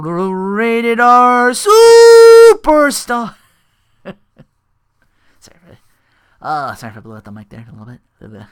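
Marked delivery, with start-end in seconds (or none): 0.0-3.3 slow, drawn-out, high and loud entrance call; ring announcer call. Retold or imitated. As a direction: belt it like an arena announcer.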